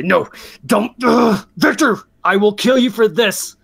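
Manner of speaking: high, strained threat through pain. Acted. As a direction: beg then threaten him with your last strength. Captive Thomas pleads, threatens.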